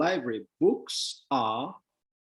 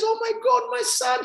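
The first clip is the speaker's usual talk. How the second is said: much higher, moved mother; mother's voice. Retold or imitated. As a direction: squeal it like a touched mom. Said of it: "Mom says".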